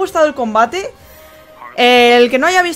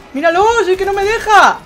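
high frustrated yell; raging gamer; 9 semitones higher, 9 dB louder than usual. Real, not acted. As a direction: complain loudly at the screen. Opponent keeps retreating.

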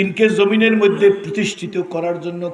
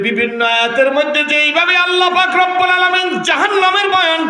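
melodic waz preaching; 13 semitones higher, 8 dB louder than usual. Acted. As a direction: preach in a sustained singing cry, stretching the phrase endings. (সুর করে উচ্চস্বরে ওয়াজ)

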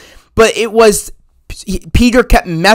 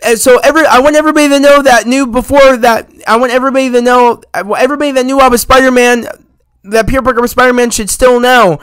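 higher, faster, keyed-up spell-casting voice; excited Doctor Strange casting the spell; 5 semitones higher, faster than usual. Retold or imitated. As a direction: blurt out the final wish in a rush. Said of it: "He's like".

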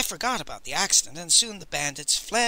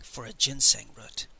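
quiet despair; 4 semitones lower, 7 dB quieter than usual. A narrator's reading. Narrator sinking into despair.

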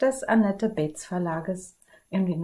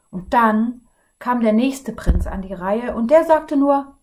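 higher and louder, building to complaint; indignant prince; 4 semitones higher, 6 dB louder than usual. Acted. Tired prince complaining to the others, rising indignation. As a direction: rant about the unfair dragon fights. Weary prince tells grievance.

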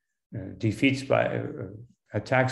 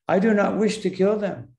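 higher-pitched, mildly defiant refusal; commanding; 7 semitones higher than usual. Acted. Arjuna refuses Krishna's wish to fight, mildly commanding. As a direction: lightly voice a firm refusal to his Lord. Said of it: "he's commanding Krishna".

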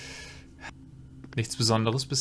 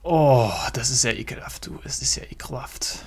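disgusted whisper. Real, not acted. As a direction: breathe out your disgust.

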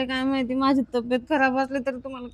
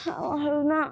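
ordinary talk

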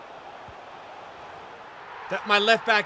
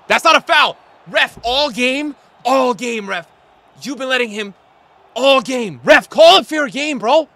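loud, angry protest at the referee; furious at the ref; 7 semitones higher, 11 dB louder than usual. Real, not acted. Gamer yelling at the referee over a bad foul call, furious shout. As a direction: yell at the ref like he's robbing you.

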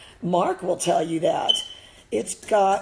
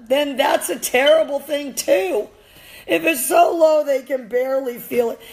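heated outburst; 7 semitones higher, 5 dB louder than usual. Real, not acted. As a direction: push the voice up, indignant. Arguing against low e-collar settings.

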